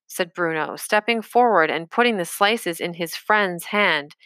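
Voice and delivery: ordinary delivery, nothing marked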